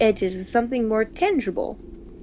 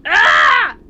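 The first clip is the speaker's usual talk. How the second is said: high, loud frightened shriek; startled scream; 7 semitones higher, 13 dB louder than usual. Acted. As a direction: cry out in sudden fright. Startled scream while reading.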